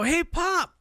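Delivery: high call out the window. Retold or imitated. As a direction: call across the parking lot. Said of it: "she yells out the window".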